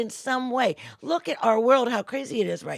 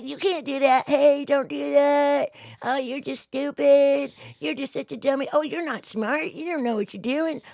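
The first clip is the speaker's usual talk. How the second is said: higher, lightly mocking put-down voice; belittling inner voice; 6 semitones higher, 4 dB louder than usual. The little identity insulting the listener, in a higher mocking taunt. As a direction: taunt yourself like a petty bully. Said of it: "this little thing that wants to, just like".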